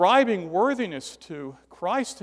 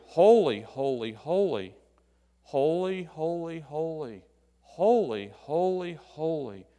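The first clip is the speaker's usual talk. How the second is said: nine times "holy" in three groups of three, pausing between groups; measured triple "holy", repeated three times; grouped in threes. Shown as a demonstration. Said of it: "It is broken into three".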